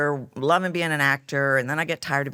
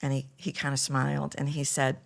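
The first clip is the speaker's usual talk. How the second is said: ordinary talk, nothing marked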